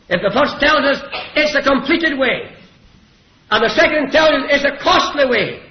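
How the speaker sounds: high, loud, emphatic preaching. Real, not acted. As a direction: hammer each point home. Preaches the points at force.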